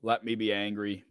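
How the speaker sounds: quiet, grumbling plea; angry. Real, not acted. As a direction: insist on being left to stew. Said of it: "I'm just angry".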